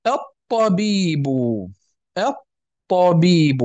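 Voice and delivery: الطبيب very slow, "at...tabiibu", long hold on the ta; doubled ta. Shown as a demonstration. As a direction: stretch الطبيب and lean hard on the doubled ta. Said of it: "غير مشددة، فأصبحت مشددة".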